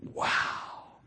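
soft breathless wow; whispered awe. Retold or imitated. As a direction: whisper it, overwhelmed. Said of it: "you're just gonna be like".

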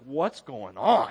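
slow, puzzled Cuban bystanders; puzzled locals. Retold or imitated. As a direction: drag it out, baffled. Cuban locals react to ration book.

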